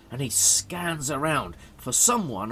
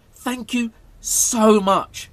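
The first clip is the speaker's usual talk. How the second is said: slow, high, loud heartfelt thanks; grateful; 8 semitones higher, 9 dB louder, much slower than usual. A narrator's reading. Harold thanks the girl who let him go, gushing gratefully. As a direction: gush with relief, stretching the words out.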